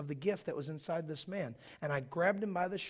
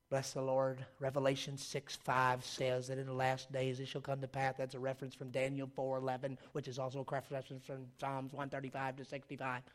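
low, monotone scripture-citing patter; Jack Van Imp; 4 semitones lower, 5 dB quieter than usual. Acted. Preacher mimics a prophecy teacher citing verses, monotone impression. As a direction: reel off cross references on one unbroken note. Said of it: "That was my Jack Van Imp impression".

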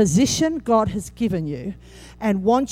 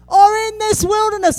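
whiny complainer; 11 semitones higher, 6 dB louder than usual. Retold or imitated. Pastor voicing the complaining Israelites, mock whine. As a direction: moan it like a sulking crowd.

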